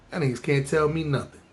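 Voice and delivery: usual speaking voice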